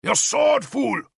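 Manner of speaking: high, loud scold; impatient shout. A narrator's reading. King scolding a slow guard, loud. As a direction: yell at the fumbling guard.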